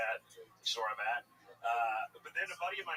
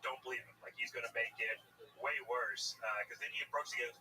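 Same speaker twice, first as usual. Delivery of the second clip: usual speaking voice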